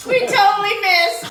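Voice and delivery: laughing. Real, not acted. Loud laughing.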